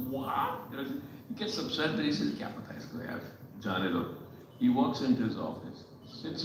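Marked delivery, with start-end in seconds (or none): 0.1-0.7 a stunned, half-voiced what; taken aback by the cleaning lady's remark, near whisper; breathy disbelief. CEO reacts in disbelief. 2.3-4.0 upset boss mutters to himself, brushing off the cleaning lady; muttered dismissal. Retold or imitated.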